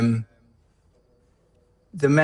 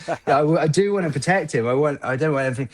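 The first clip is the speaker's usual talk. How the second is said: higher, louder, rushing protective dad; a hint of an anxious dad pleading, higher and hurried; 5 semitones higher, 4 dB louder than usual. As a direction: blurt it out like a worried father who can't bear it. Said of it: "you're like".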